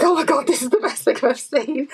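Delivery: high-pitched gushing of a delighted craft fan; re-enacted fangirl squeal of delight; craft-geek excitement. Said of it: "I just craft geeked".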